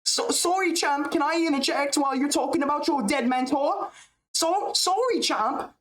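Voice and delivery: very high mocking voice; whiny falsetto mockery of a fighter; mocking Ian Gary. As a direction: squeak it out as the annoying interrupter. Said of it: "when you hear someone's voice like this".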